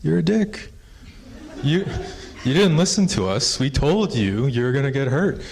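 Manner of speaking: high, loud scolding refusal; scolding thunderbirds. Retold or imitated. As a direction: tell him off from above. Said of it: "they're like".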